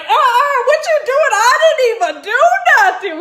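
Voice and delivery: high, loud comic outrage; alarmed protest. Retold or imitated. Suspect protesting to a cop grabbing the wrist, loud alarm. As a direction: protest your innocence at the top of your voice.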